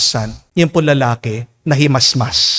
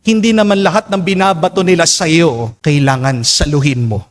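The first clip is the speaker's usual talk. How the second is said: ordinary talk, nothing marked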